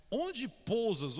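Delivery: drawn-out quote. Acted. Quoting disciples' archaic question.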